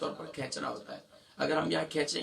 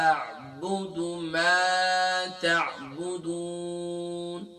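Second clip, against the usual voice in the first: slow Quran recitation; much slower than usual. Shown as a demonstration. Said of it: "recites the verse as practice".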